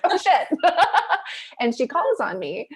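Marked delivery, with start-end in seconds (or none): 0.0-1.6 a high, loud laughing gasp of alarm; sudden laughing panic; laughing oh shit. Retold or imitated. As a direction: caught off guard by her own raised hand, laughing. Hand rises, shocked at herself.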